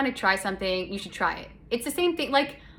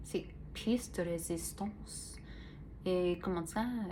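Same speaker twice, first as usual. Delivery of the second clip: slow, softer French accent, hammed-up but light; mock French accent; 2 semitones lower, 11 dB quieter, slower than usual. Shown as a demonstration. Slow soft mock French accent for her podcast listeners. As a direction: try a bad French accent. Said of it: "I try to do the French".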